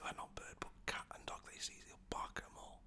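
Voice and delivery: ordinary talk, nothing marked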